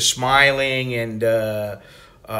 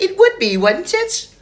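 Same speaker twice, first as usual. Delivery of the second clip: much higher prim English voice; British lady impression; 3 dB louder than usual. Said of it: "she's like".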